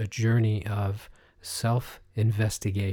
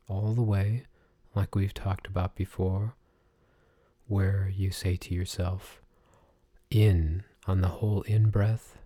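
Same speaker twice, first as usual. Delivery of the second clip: guided meditation. Acted. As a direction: talk them gently through each breath. Leads breathing meditation.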